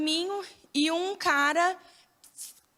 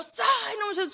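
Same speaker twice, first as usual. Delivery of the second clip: frightened command; 7 semitones higher than usual. Retold or imitated. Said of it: "com muito medo".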